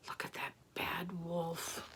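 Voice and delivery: slow, very soft aside; drawn-out, secretive whisper; hushed whisper. Acted. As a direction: whisper it conspiratorially to a small child. Hushed aside over sleeping wolf.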